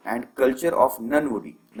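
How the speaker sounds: very slow, word by word; slow dictation. Shown as a demonstration. Teacher dictating the rest of the definition slowly. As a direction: let students copy every word. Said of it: "teacher dictating the definition".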